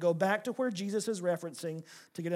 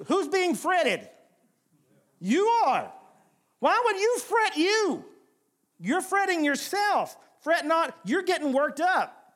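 high, loud, driving sermon climb; preaching at full force; 6 semitones higher, 8 dB louder than usual. Real, not acted. Preacher pressing the congregation on fretting, preaching at full force. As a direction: preach it hard and rising to the crowd.

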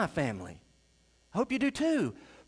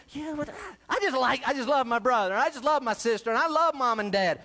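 higher, insistent mock objection; imitated protest; 5 semitones higher, 3 dB louder than usual. Churchgoer defending love for brother, sister and parents, protesting. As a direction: plead the case like a defensive churchgoer. Acts out congregation's protest about family.

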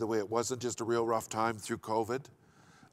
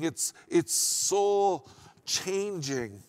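raised, driving pulpit voice; preaching; 3 semitones higher, 3 dB louder than usual. Acted. Pastor urging the congregation to hear, full preaching voice. Preacher builds excitement over testimony.

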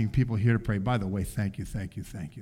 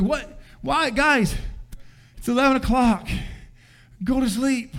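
put-on higher-pitched exasperated plea to the kids; a tired parent begging at bedtime; exasperated dad; 10 semitones higher than usual. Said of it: "I mean, like".

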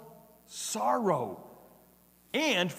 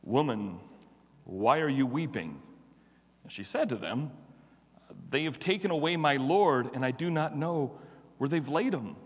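lower, measured Bible reading; lower-pitched solemn reading of scripture; 5 semitones lower than usual. Acted. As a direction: read the passage with reverence. Reading the Gospel passage aloud.

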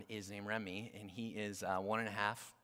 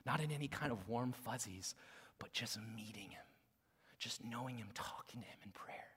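very soft, near-whispered preaching; hushed appeal; 3 semitones lower, 7 dB quieter than usual. Real, not acted. Preacher asking congregation about God's presence, softly and earnestly.

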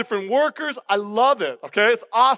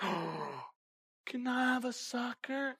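put-on much softer, small child's ask; little girl asking; 17 dB quieter, slower than usual. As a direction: ask sweetly and quietly like a toddler. Toddler asking for candy.

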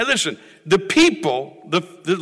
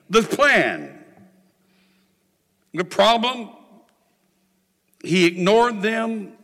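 ordinary talk, nothing marked

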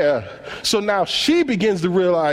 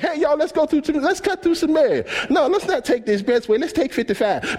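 casual mock Jesus; 7 semitones higher than usual. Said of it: "It's not something simple like".

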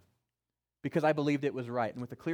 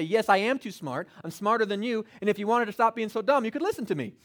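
mock arrogant retort; 5 semitones higher, 6 dB louder than usual. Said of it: "Paul could say".

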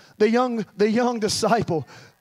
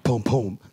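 low double thump; low-pitched pum-pum pulse; imitated heartbeat; 11 semitones lower than usual. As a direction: voice a deep heartbeat in two beats. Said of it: "hear that heartbeat".

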